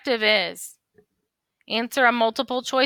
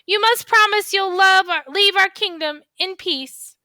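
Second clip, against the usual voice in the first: high, loud, firm princess voice; commanding princess; 10 semitones higher, 4 dB louder than usual. Acted. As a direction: order it like a brave princess.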